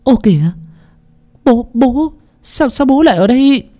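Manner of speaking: high, startled stammer; shocked exclamation. A narrator's reading. Daughter-in-law startled by her father-in-law: shocked stammering cry. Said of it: "Cô Lan thốt lên".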